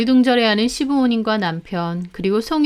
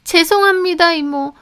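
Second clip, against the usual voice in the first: higher, fading apology; meek apology; 8 semitones higher, 4 dB louder than usual. A narrator's reading. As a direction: apologise meekly. Maid apologises.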